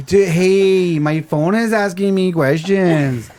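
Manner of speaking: slow, high, dazed stoner talk; stoned voice. Pretend. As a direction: sound totally baked. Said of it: "in a stoned voice".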